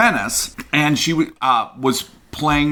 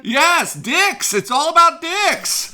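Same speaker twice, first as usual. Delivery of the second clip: excited; 14 semitones higher, slower than usual. Real, not acted. Jokey outburst about dick stories.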